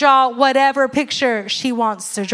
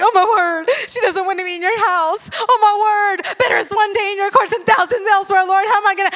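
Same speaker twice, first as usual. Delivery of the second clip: high, teary hammed-up prayer; mock lament; 7 semitones higher than usual. Mother lamenting to the Lord that her daughter skips worship, hammed-up. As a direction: wail to God like a wounded mom.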